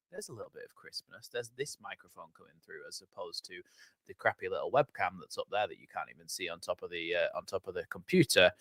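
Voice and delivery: hushed, voiceless whisper, quickly; imitating a viewer. Streamer imitating viewers noticing the crisp audio, whispered. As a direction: whisper the viewer's reaction fast. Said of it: "you go".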